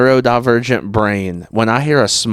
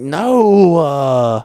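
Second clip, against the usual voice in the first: drawn-out no; 6 semitones higher than usual. Retold or imitated. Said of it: "draw it out".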